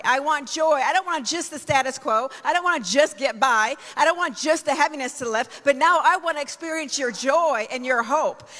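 rising, fervent praise prayer. Real, not acted. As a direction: pray it out with building fervour. Prays aloud asking for joy.

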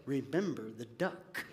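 soft, hushed blackmail; whispered threat. Acted. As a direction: hiss the reminder under your breath. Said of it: "she looked over at him and said".

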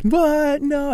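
high-pitched mock crying-laughing disbelief; imitated tearful disbelief. Retelling a brother's reunion, imitated tearful disbelief. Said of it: "crying, laughing".

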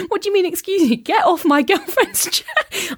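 high-pitched imitation of an irate man, laugh creeping in; mock indignation. Annoyed man ordering her off his girlfriend's chair, mock indignant.

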